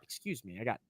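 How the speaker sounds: queasy burp-like voice; heartburn. Real, not acted. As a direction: talk through heartburn. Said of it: "some heartburn".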